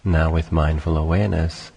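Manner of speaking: calm, unhurried meditation voice with long pauses; guided meditation. Acted. Teacher leading listeners through sitting and breathing, calm guided meditation. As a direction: guide listeners gently into stillness, phrase by phrase.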